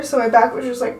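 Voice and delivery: ordinary delivery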